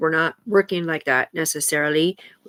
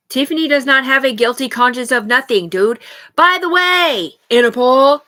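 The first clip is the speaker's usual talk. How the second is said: forceful, raised voice; loud defiant declaration; 4 semitones higher, 8 dB louder than usual. Real, not acted. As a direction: announce it loudly in her defence. Loudly defends Tiffany to accusers.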